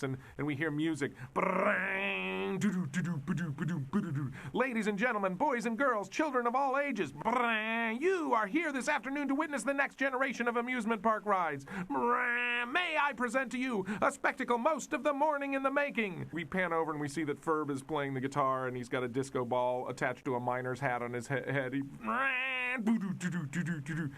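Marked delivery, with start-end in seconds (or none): 1.3-4.3 imitated guitar riff. Disco guitar kicks in. 4.5-16.3 high, booming ringmaster pitch with guitar-noise fills; showman announcer. Acted. Phineas introducing the coaster to the crowd, grand showman voice. 21.8-24.1 imitated guitar riff.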